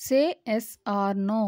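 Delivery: said slowly and smoothly as one phrase; teacher saying the whole sentence slowly; slow model sentence. Shown as a demonstration. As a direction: read "Say yes or no." slowly as a single phrase. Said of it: "దీన్ని ఇంగ్లీష్లో ఏమనాలి?".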